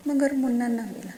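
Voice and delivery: plain reading voice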